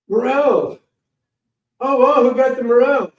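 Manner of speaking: light up at the sight of the mushrooms; excited dad. Retold or imitated. Dad excited at seeing morels.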